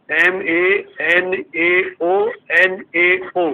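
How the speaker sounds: each letter drawn out slowly; slow dictation. Shown as a demonstration. Teacher dictating the repeated form to the class, slowly. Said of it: "फिर नीचे लिखो".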